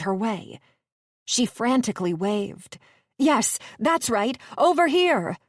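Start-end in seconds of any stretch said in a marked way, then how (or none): 3.2-5.4 rising into a high, loud shout; calling out. A narrator's reading. Gabby luring the men toward her, rising loud shout. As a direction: urge them on with the yell.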